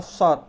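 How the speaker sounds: "sod" with pouted lips, dark s; emphatic rounded "sod". Shown as a demonstration. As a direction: push lips forward on "sod". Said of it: "mulut kita harus monyong".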